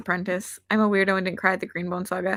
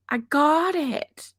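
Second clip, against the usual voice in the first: slow, hushed whisper of realisation; a slow, whispered aha; 6 semitones higher, much slower than usual. Real, not acted. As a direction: breathe it out slowly as it dawns.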